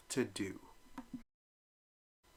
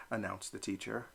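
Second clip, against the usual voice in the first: ordinary delivery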